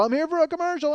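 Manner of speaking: high, pleading mock whine; whiny protest. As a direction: squeak it out as he's shoved away. Pleading while pushed out door.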